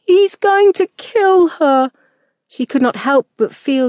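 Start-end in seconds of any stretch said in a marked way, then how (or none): 0.0-1.9 put-on high, slow frantic boy's cry; boy calling out. A narrator's reading. Boy crying out to the fight in the distance, panicked. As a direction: call out in panic as the young boy. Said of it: "Perry calling out".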